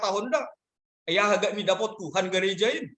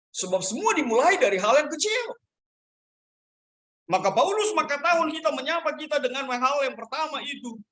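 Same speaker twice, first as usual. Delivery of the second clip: raised, high-pitched pulpit exhortation; preaching; 8 semitones higher than usual. Acted. Pastor urging the congregation to be humble, preaching.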